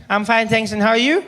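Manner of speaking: put-on high little boy's voice on the phone; nine-year-old brother. Little brother phones his big brother, high polite child voice. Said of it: "he's too polite".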